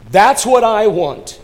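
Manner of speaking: high, loud imitated outcry; calling out. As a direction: cry it out slowly as the homeless man. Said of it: "this homeless man calls out".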